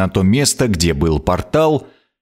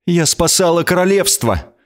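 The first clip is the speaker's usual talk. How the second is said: strained voice; 8 semitones higher than usual. A narrator's reading. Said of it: "произнесла она сдавленным голосом".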